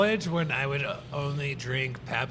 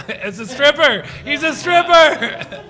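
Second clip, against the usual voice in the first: laughing shout; 9 semitones higher, 8 dB louder, slower than usual. Real, not acted.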